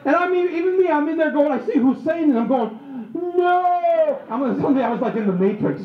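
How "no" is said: high, drawn-out mock "no"; hammed-up horror, held long; slow-motion cry. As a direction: stretch it like a movie slow-motion wail. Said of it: "you know, in slow motion".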